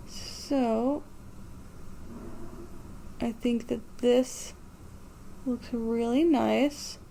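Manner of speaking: slow speech with jaw dropped open; mascara face talk. Real, not acted. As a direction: talk with the mouth stretched like applying mascara. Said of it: "doing, like, mascara face talk".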